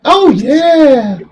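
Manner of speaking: high falsetto "oh yeah"; squeaky put-on girl voice; fake girl voice. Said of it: "fake girl voice".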